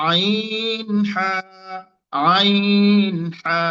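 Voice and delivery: আইন pressed from the mid throat, হা breathed out; Arabic ain, mid-throat letter. Shown as a demonstration. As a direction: squeeze আইন from the middle of the throat. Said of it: "কণ্ঠের মাঝখান থেকে দুটি অক্ষর".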